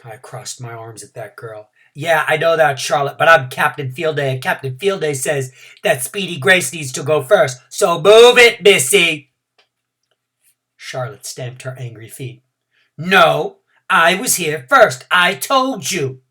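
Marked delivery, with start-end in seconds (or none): 1.9-9.3 louder bossy comeback; bossy retort. Acted. Junie B bossing Charlotte as captain, loud and sassy. 13.0-16.2 louder, slow snappish insistence. Acted. Charlotte snapping back that she was first, slow and louder. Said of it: "She said it real snappish".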